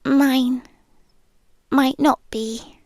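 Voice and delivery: ordinary delivery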